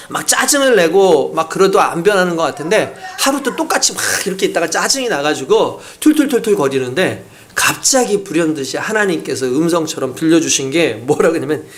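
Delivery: normal talking voice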